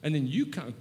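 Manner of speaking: ordinary talk, nothing marked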